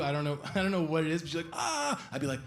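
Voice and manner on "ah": high, contained mock scream; small high squeal, held back; polite quiet scream. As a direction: imitate a girl's polite little scream. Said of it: "polite, quiet scream that girls do".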